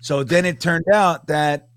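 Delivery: usual speaking voice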